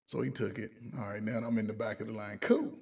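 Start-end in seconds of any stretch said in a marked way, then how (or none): 2.4-2.8 a high, loud, breezy cool; carefree cool. Retold or imitated. Losing the badge and shrugging it off, carefree. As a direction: toss it off like a weight is gone.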